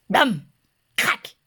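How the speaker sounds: plain reading voice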